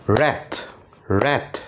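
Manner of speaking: র্যাট said as one clapped beat; clapping syllables. Shown as a demonstration. Teacher claps syllables for students, one beat. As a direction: clap once on র্যাট. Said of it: "আমরা তালির মাধ্যমে সেই ওয়ার্ডগুলোকে শিখব".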